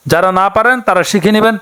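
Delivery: normal talking voice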